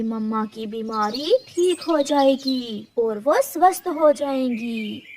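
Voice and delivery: low, gentle comforting tree voice; kind old cactus tree. Acted. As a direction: soothe the frightened little bird. Cactus gives mangoes.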